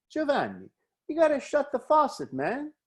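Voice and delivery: a student calling out the obvious fix; eager answer. Retold or imitated. Audience voiced answering the speaker.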